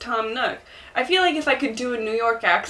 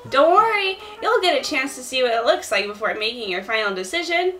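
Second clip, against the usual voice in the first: high-pitched, eager helper voice; little shop helper; 3 semitones higher than usual. Acted. Explaining tent placement, high helper voice. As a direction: chirp the instructions up high. Plays Timmy or Tommy.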